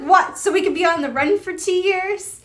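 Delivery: mock outraged disbelief; incredulous retort. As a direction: throw it back as if it's absurd. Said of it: "And he's like".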